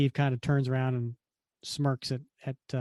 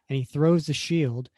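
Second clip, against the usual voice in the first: ordinary talk, nothing marked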